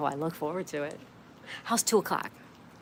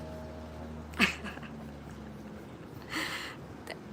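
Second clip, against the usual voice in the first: soft laugh; 5 semitones higher, 8 dB quieter than usual. Real, not acted. Laughing after reading comment.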